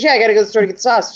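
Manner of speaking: rattled off very fast, offhand; fast casual reply. Retold or imitated. Son's girlfriend shrugging off the question, fast and offhand. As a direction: toss it off quickly like it's obvious. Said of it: "She goes".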